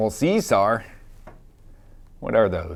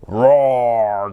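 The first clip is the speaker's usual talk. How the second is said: louder, growling roar; lion roar; 5 dB louder than usual. Acted. Reading the lion page aloud, a loud growling roar. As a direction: let the lion pack roar.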